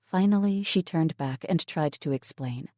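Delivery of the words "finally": plain reading voice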